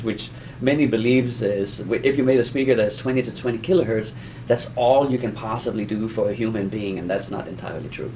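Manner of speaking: ordinary delivery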